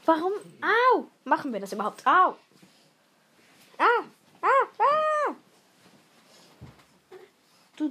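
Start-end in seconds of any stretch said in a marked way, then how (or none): 0.6-1.1 high loud ow; ouch cry. Real, not acted. Sister yelping as her brother scratches her, loud ow. 2.0-2.4 loud ow; ouch cry. Real, not acted. Sister yelping at her brother, loud ow. Being scratched. 4.8-5.4 very high scream. Real, not acted. Sister shrieking while wrestling her brother, high scream.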